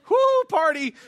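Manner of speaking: high, loud mock whoo with a laugh; sarcastic cheer.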